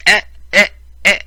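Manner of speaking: high, loud playful eh eh; a loud, high mocking vocal flourish; mock accent exclamation. Hamming up the accent for viewers, loud playful exclamation.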